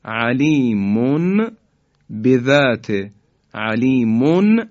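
علیمون بذات recited slowly; slow recitation. Shown as a demonstration. Teacher reciting علیمون بذات. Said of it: "و آخرین کلمه".

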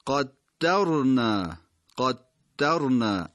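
قدرنا very slow, with the doubled dal held; doubled consonant demo. Shown as a demonstration. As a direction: lean on the geminate in قدرنا. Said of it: "تشدید داره این کلمه".

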